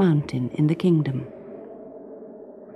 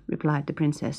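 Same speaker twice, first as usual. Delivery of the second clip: ordinary delivery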